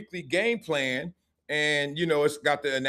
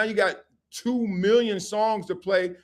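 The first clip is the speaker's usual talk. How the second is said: ordinary talk, nothing marked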